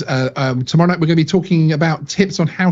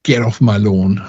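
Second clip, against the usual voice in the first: grumpy landowner; 4 semitones lower than usual. Acted. Guest voices a grumpy landowner.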